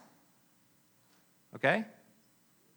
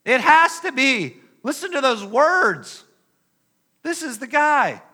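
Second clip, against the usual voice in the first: higher and louder, breathless realization; excited recognition; 9 semitones higher, 5 dB louder than usual. Acted. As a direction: it has to be him, realize it aloud. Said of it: "Now they recognize".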